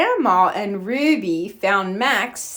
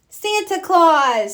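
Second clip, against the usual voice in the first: little Max; 8 semitones higher, 4 dB louder than usual. A narrator's reading. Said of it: "said Max".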